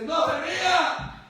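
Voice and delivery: high, loud, slow mock scolding; a frightened brother on the table snapping at the laughing pastor; indignant yell. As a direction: shout it like a scared man told off for being laughed at.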